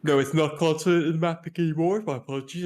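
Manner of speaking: talking through a yawn; real yawn. Real, not acted. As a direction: push the sentence out mid-yawn. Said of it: "I've just got a yawn here".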